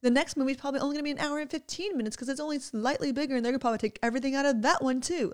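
hammed-up childish complaint, rushing the words; imitating kids' outrage. Mom voicing her girls' outrage at the movie, mock frustrated.

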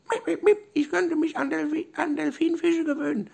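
very high, squeaky dolphin voice; high-pitched cartoon dolphin chirps and speech; squeaking dolphin. A narrator's reading. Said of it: "Der Delfin giekste".

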